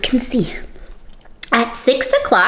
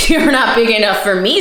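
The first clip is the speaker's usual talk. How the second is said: louder huffy bug excuse; grouchy ladybug; 5 dB louder than usual. A narrator's reading. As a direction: bluster to hide being scared. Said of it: "said the grouchy ladybug".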